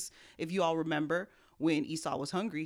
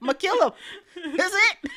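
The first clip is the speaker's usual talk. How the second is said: much higher and louder, laughing through the threat; laughing mock threat; 13 semitones higher, 11 dB louder than usual. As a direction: ham up the murder plan while cracking up. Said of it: "He's like".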